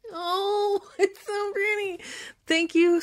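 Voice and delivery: high, hushed, awestruck delight; breathless, tender delight at a gift; delighted gasp. Real, not acted. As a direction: marvel at a gift you have wanted for years. Sees the plant, delighted.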